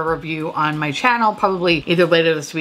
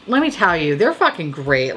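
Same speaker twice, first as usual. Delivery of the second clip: ordinary talk, nothing marked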